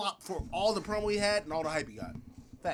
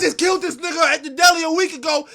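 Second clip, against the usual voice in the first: mock rapper boast; 6 semitones higher, 6 dB louder than usual. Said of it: "he like".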